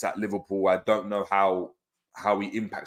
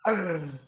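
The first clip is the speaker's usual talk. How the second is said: high, softer laugh sliding down in pitch; tail-end laugh; 9 semitones higher, 5 dB quieter than usual. Real, not acted. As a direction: let the laughter drain out. Laughter after the clip.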